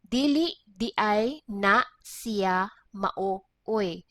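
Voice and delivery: very slow, word by word; slow teaching pace. Shown as a demonstration. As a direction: spell out each word slowly for learners. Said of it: "slow it down".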